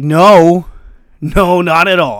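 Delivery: loud, slow disbelieving no; an inner voice scoffing at a tall tale; emphatic inner no. Retold or imitated. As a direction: flatly refuse to believe it.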